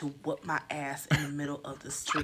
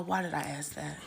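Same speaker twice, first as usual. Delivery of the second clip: ordinary talk, nothing marked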